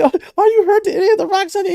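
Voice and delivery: high, loud worried fretting; fussing parent. Acted. Parent checking kid for injuries from rocks, high fretting. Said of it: "And it was like".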